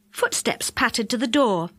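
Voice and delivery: plain reading voice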